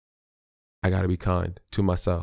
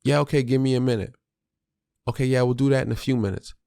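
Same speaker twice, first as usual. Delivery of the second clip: brushing-off parent; 5 semitones higher, faster than usual. Retold or imitated. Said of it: "Don't hit 'em with the".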